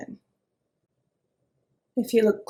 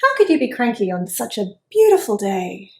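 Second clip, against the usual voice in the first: dreamy worm; 3 semitones higher, 7 dB louder than usual. Acted. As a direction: savour each word like a delighted little worm. Worm admires the day.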